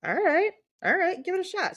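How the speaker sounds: bright, high teasing dare; high playful challenge. Real, not acted. Friend daring the other to decipher the accent, teasing.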